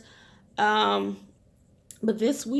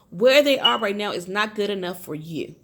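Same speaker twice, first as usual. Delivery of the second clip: usual speaking voice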